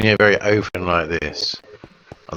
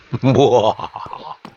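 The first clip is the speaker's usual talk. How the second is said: evil laugh; 5 semitones higher, 4 dB louder than usual. Acted.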